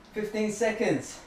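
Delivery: ordinary talk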